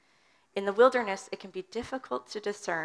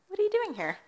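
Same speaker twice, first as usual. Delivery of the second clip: curious question; 12 semitones higher, faster than usual. Shown as a demonstration. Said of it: "we can't always infer tone".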